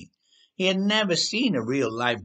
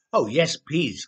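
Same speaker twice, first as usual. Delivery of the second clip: louder, eager delighted yes; delighted squeal; 4 semitones higher, 5 dB louder, slower than usual. A narrator's reading. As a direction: squeal with joy at the offer. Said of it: "squealed delightedly".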